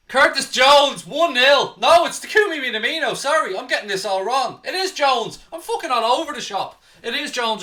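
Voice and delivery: high, very loud celebration shout; excited goal call. Real, not acted. Fan commentator calling his team's opening goal, excited shouting. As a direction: yell the goal out wildly, tripping over the scorer.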